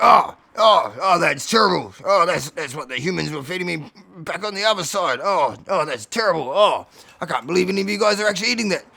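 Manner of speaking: loud, groaning disgust; appalled. Acted. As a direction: lament it loudly, oh after oh. Alfie appalled by biscuit rations.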